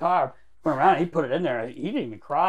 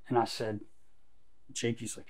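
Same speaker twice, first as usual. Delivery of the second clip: ordinary talk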